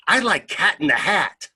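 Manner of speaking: high, loud mock-child answer; little girl's blurt. Little girl answering the visiting reader, eager imitated blurt.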